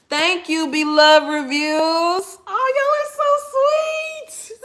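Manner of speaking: high, drawn-out sweet squeal; gushing thanks. Real, not acted. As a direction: gush at the viewers in a high, stretched voice. Gushing thanks to live viewers.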